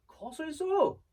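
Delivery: insistent director. Retold or imitated. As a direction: imitate a director pressing the host. Director insistently repeats the instruction.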